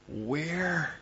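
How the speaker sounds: ordinary talk, nothing marked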